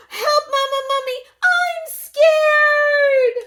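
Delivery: a panicked little mummy yelling for mama; scared shout. Acted. Baby mummy shrieks for mama at the mouse.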